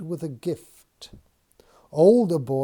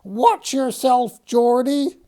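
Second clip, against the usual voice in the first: high, loud, slow ogress warning; screechy warning; 11 semitones higher, 7 dB louder than usual. Acted. Ogress shoe warning George, slow screechy threat. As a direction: threaten him like a cross ogress. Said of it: "warns the screechy one".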